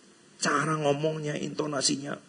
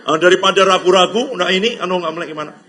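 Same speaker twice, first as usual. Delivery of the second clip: excited preaching; 7 semitones higher, 11 dB louder than usual. Real, not acted. Rising fervour in sermon.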